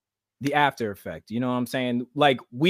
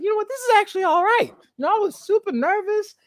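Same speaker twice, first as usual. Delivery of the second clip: imitating a relaxed sister in a much higher voice; mock voice of sister Ebony. Host quoting sister Ebony to stream guests, high mock voice. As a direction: play her like she's pleasantly surprised, pitched way up. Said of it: "She's like".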